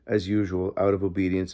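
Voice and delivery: ordinary delivery, nothing marked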